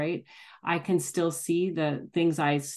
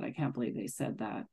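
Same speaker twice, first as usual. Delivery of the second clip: much softer and lower, under the breath; muttered inner thought; 6 semitones lower, 10 dB quieter than usual. Retold or imitated. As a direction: grumble it to yourself. Inner thought about irritating person.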